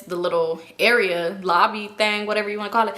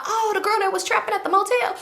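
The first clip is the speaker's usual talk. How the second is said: high, put-on voice of a confused viewer; playing the audience asking, pitched up; mock viewer voice; 10 semitones higher than usual. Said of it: "And y'all be like".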